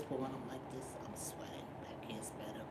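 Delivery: unvoiced close whisper. Real, not acted. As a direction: lean in and whisper it. Leaning close to the mic.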